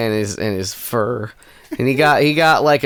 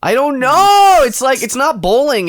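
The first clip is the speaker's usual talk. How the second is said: exasperated high-pitched; 11 semitones higher, 5 dB louder than usual. Real, not acted. Frustrated search for word.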